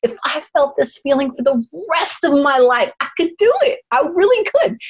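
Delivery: high, earnest vow; fervent declaration. Retold or imitated. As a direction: declare it with full conviction. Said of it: "I was like".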